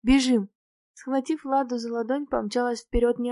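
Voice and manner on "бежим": sharp, louder order to run; loud one-word rallying order; urgent command. A narrator's reading. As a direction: give a sudden raised-voice command. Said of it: "скомандовала".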